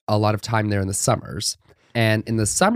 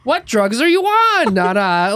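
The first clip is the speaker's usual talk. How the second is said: high, louder mock disbelief; incredulous outburst; 11 semitones higher, 4 dB louder than usual. Asking grandma in the car what drugs she's on, in high incredulous outburst. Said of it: "And I'm like".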